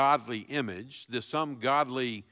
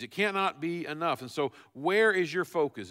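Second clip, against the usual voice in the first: usual speaking voice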